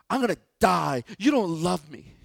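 put-on loud, high whiny child wailing; dramatic kid. Pastor mimics a disciplined child, loud dramatic whine. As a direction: wail it like an overdramatic kid. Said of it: "kids can be very dramatic".

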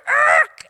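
a loud high screech like a record stopping dead; record scratch noise. Retold or imitated. Preacher mimics a record scratch, loud and high. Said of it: "the record".

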